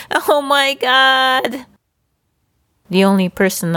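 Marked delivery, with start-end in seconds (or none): 0.1-1.7 mock startled cry, high and slow; a drawn-out high gasp of fright; cried out. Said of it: "I yelled out loud in the plane".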